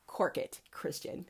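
low, soft "cork it" to a heckler; hushed retort. Real, not acted. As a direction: shush him quietly.

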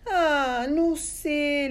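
high, whiny little creature voice; tiny thin voice. Acted. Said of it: "le contestó la voz finita". (vocecita finita y aguda)

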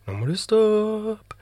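high, sing-song morning call; cheery wake-up voice. Retold or imitated. Dad waking his kids, high sing-song cheer. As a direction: chirp it like a bright wake-up. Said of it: "jeg kommer inn".

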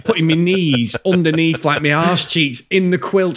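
ordinary talk

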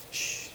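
whispered shh; a soft hush to close Job's words. Shown as a demonstration. Preacher hushing after Job's confession, soft shh.

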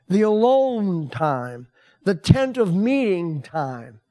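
usual speaking voice

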